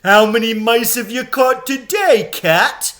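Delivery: high, loud mocking question; taunting jeer. A narrator's reading. Crowd taunting Aslan, high and loud. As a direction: needle him with scorn. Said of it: "jeering at him".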